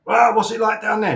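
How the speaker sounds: usual speaking voice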